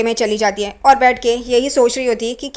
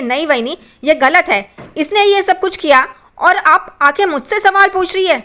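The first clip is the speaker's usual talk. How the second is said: hurt outrage pushed up into a higher voice; indignant protest; 6 semitones higher than usual. A narrator's reading. Riya protesting to her sister-in-law, indignant and raised.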